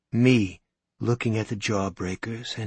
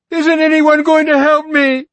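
much higher, much louder desperate scream; 15 dB louder than usual. Acted. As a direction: scream for help at the crowd. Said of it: "she screamed".

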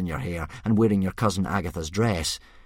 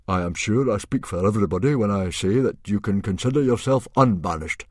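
awkward formality; 3 semitones higher, 6 dB louder than usual. A narrator's reading. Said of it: "Stoic gave an awkward cough".

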